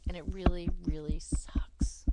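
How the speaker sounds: heavy, emphatic grievance; angry venting. Pretend. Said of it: "that I'm so angry".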